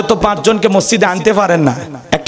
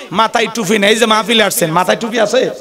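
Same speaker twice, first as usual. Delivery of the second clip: ordinary talk, nothing marked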